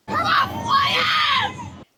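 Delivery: high Scottish football shout; broad Scottish accent. Retold or imitated. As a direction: yell it like a Scottish fan. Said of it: "really thick Scottish accent".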